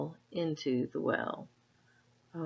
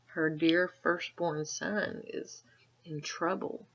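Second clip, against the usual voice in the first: ordinary delivery, nothing marked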